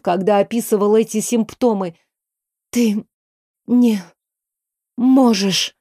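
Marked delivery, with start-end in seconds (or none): 2.7-5.7 out of breath. A narrator's reading. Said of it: "Дыхание сбивалось".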